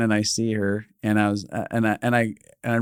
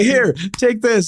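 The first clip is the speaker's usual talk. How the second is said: high, slow, pushy handing over; insistent offer; 11 semitones higher, 4 dB louder, slower than usual. Retold or imitated. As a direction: press it on her gleefully. Forces money into her hand.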